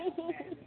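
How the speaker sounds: soft high chuckle; laughing. Real, not acted. Laughs at the threat.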